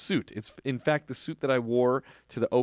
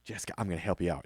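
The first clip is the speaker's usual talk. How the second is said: quick reassurance; 7 semitones lower, 8 dB quieter, much faster than usual. Retold or imitated. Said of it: "he'll say".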